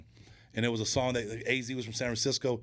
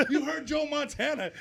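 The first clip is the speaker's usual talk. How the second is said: mock accusation. Brother accuses Al Noga.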